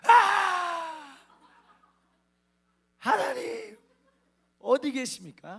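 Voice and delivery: loud, high yelled cry over traffic noise; shouting to God. Retold or imitated. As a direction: yell it out, pleading with God. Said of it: "소리를 막 지른 거죠".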